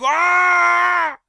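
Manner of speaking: very high loud scream; loud high shriek of pain. Retold or imitated. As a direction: scream like you just got maced. Doug gets maced.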